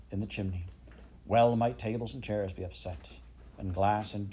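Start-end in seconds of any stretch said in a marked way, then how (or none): none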